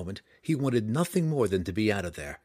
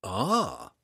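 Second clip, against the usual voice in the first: plain reading voice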